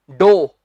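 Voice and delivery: "डो" loud, long o, no f; correct pronunciation. Shown as a demonstration. Teacher correcting the class, loud and clear. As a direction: land "डो" firmly, rhyming with go. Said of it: "ये है".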